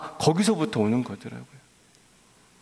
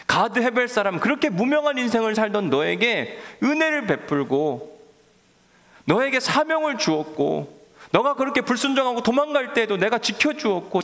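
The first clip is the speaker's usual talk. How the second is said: higher, pleading voice of God; God reasoning with Jonah, higher and pleading; 8 semitones higher than usual. Acted. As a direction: plead with a stubborn prophet, voice rising. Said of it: "요나를 향한 하나님의 마음이었어요".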